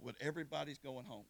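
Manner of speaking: low, soft retelling of a guard's promise; hushed storytelling. Retold or imitated. Guard promises the waiting convicts they go home, hushed. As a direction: drop low and quiet, measured reassurance.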